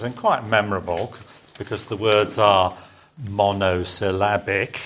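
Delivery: normal talking voice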